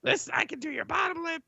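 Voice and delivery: quick, high NPC patter; high eager helper. Acted. GM voicing a stylist pushing lipstick, high and quick. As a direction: rush the offer in a high voice.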